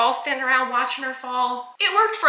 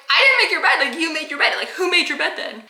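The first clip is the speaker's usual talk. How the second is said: high, louder denial, half laughing; heated mock arguing; 5 semitones higher, 4 dB louder than usual. Arguing with the boy over his bed, baffled insistence. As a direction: insist in disbelief, pitched up. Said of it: "we are arguing back and forth".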